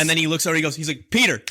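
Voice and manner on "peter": high, loud call of a name; mock summons. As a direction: call out sharply for your henchman. Acted boss calling his helper.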